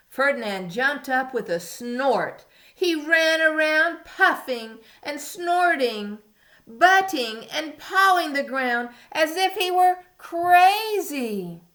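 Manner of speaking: put-on reading to a toddler. A narrator's reading. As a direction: read it big for little kids. Reading story to young children.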